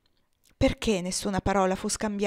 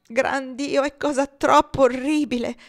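grido disperato, più alto e più forte; despairing cry; 5 semitones higher, 7 dB louder than usual. A narrator's reading. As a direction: esplode di disperazione sul letto della figlia. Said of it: "con disperazione". (il padre grida disperato davanti alla figlia morente)

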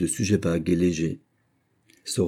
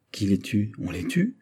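horrified chorus; 2 semitones higher than usual. A narrator's reading. Horrified friends learn of the killing, appalled questioning. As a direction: ask in appalled disbelief, second question leaping up. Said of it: "s'écria le chœur épouvanté".

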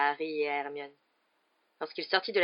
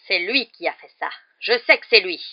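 loud, insistent accusation; angry cry; 3 semitones higher, 12 dB louder than usual. A narrator's reading. Rogue insisting Potter is to blame, exclaiming. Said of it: "s'écria Rogue".

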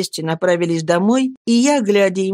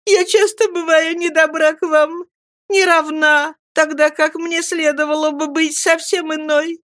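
put-on tearful young girl; 9 semitones higher than usual. A narrator's reading. Girl weeping, reproaching herself.

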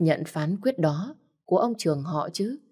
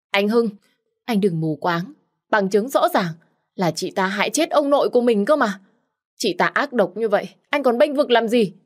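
shrill pleading; 5 semitones higher than usual. Acted. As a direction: beg him while accusing her rival. Nhã Thi pleading after crying.

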